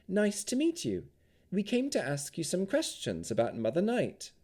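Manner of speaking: put-on bright, high young woman's voice; a high, friendly young elf. A narrator's reading.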